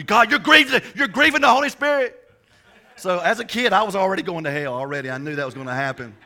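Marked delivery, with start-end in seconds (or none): 0.0-2.2 loud, high, hammed-up rebuke; mock scolding. Caught making out, the church folk scold him loudly and much higher. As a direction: play the outraged church lady, laughing through it.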